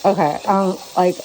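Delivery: talking around a phone in mouth; muffled, phone between teeth; phone held in teeth. Real, not acted. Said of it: "literally have the phone in my mouth".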